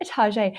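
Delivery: Target said tar-ZHAY; joking tar-ZHAY for Target; mock French Target. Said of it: "she said".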